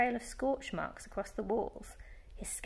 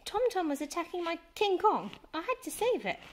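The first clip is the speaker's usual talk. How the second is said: put-on flustered boy; 10 semitones higher than usual. Boy excusing himself to mum.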